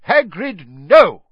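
loud, high commanding shout; sharp, booming shout; Dumbledore. A narrator's reading. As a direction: shout to stop him at once. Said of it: "Dumbledore shouted".

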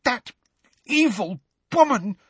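high, halting outrage; too enraged to talk easily; gasping with rage. A narrator's reading. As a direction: choke out each word in fury. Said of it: "gasped Hermione, who seemed to be having difficulty talking due to rage".

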